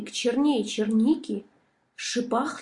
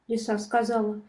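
ordinary delivery

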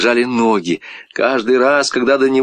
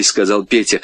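ordinary delivery

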